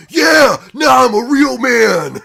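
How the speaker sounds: loud, slow, high mock macho shout; mock macho boast. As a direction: puff up and boast like a fake tough guy. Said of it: "and is like".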